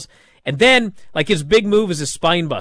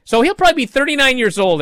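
ordinary talk

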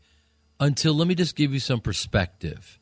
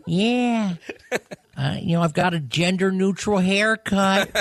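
Owen Wilson; 8 semitones higher than usual. Acted. Comedian as a celebrity satnav giving directions, high drawled impression. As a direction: stretch the vowels in a higher lilting voice. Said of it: "Owen Wilson is such a surreal one".

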